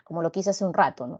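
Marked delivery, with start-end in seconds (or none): none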